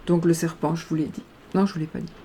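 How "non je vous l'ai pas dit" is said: ordinary talk, nothing marked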